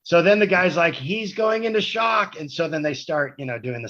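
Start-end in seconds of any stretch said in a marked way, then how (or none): 1.0-2.4 much higher, slow, drawn-out alarm; mock alarmed paramedic. Paramedic to the crew as he goes into shock, high slow mock alarm. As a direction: play the medic announcing the emergency in a high slow voice.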